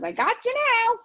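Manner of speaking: silly space-alien threat. Acted. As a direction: squeak like a cartoon alien. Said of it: "This alien says".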